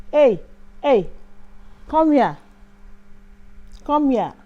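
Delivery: loud yell calling her over; driver shouting. Retold or imitated. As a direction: bellow it across the road to get her attention. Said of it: "he was shouting at".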